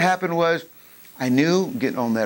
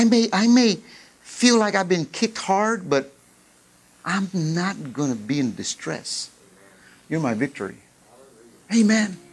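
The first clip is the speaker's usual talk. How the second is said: usual speaking voice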